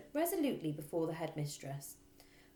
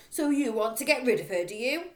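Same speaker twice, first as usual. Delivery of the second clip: very loud, higher accusing rant; furious accusation; 11 semitones higher, 11 dB louder than usual. Acted. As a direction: hurl the accusations. Trunchbull accusing.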